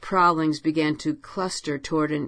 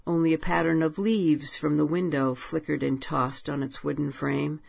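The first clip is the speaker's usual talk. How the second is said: ordinary delivery, nothing marked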